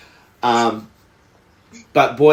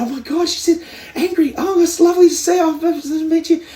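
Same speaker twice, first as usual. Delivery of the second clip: much higher, gushing starstruck fan voice; excited nervous fan. Retold or imitated. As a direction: gush like a nervous fan meeting her hero. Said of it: "she was so excited, and she was so nervous".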